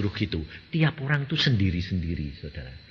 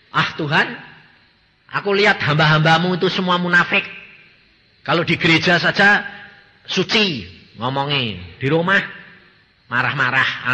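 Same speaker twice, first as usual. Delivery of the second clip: raised, louder complaint to God; a boy arguing back at God, louder; loud protesting plea; 4 semitones higher, 5 dB louder than usual. Retold or imitated. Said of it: "Aku bilang".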